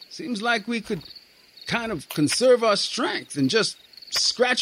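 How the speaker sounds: put-on young private grumbling to his sergeant; lightly voiced young soldier. A narrator's reading.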